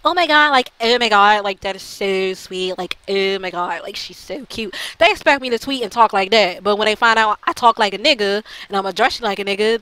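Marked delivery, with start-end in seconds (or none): 0.0-4.7 put-on super girly girl. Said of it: "a super girly girl and type".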